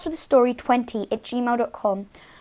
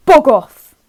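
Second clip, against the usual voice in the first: loud defiant shout; calling out; 12 dB louder than usual. Acted. Gertrude calls at something in the dark, loud. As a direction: shout it into the dark to scare it away. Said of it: "she called out".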